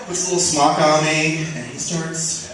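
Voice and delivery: ordinary talk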